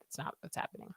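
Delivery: a soft, voiceless aside to the chat; whispered aside. Real, not acted. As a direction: whisper it under your breath.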